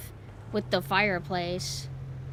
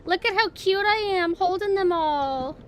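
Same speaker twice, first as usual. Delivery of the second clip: cutesy; 8 semitones higher, 4 dB louder than usual. Real, not acted. Admiring her avatar.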